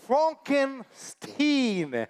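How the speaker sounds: "Frankenstein" said slowly as FRONK-en-STEEN; "Frankenstein" with a long, emphatic "steen" ending; corrective pronunciation. Acted. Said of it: "My name is pronounced Frankenstein".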